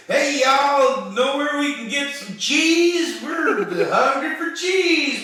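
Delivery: loud hammed-up Midwest accent. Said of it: "speak in really, like, Midwest accents".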